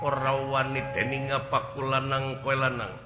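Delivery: normal talking voice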